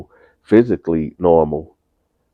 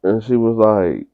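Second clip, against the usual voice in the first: ordinary delivery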